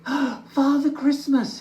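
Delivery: high, breathless and slow with wonder; gasped surprise. Acted. Little Bear hears footsteps on the roof, gasping high. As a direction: gasp the name in amazement. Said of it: "he gasped".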